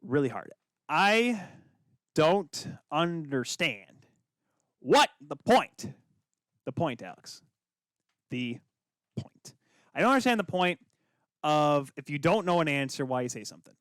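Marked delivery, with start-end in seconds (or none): 0.9-9.5 very slow, word by word with long pauses; exasperated, deliberate. Real, not acted. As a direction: spell it out slowly for a co-host who won't get it. Exasperated, spelling out his objection.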